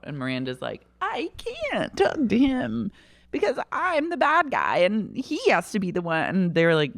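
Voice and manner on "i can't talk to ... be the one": mock Miranda. Miranda voiced in retold scene.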